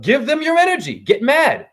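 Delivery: ordinary talk, nothing marked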